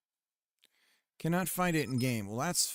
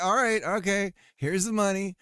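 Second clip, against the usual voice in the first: mock official; 6 semitones higher, 5 dB louder than usual. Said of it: "It's like".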